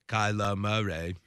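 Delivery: low, soft Southern simpleton impression; Forrest Gump. Host echoing the co-host's slip, Gump impression. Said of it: "You sounded like Forrest Gump".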